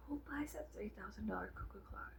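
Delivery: incredulous whisper. Real, not acted. Vlogger marveling at prices, whispered aside. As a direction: hiss it in disbelief.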